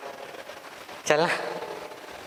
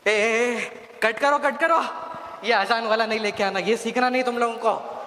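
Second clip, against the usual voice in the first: higher, hurried, nervous laughing orders; flustered devil; 8 semitones higher than usual. Acted. Devil ordering minions to cut the azan clip, flustered. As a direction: laugh it off while frantically demanding a cut.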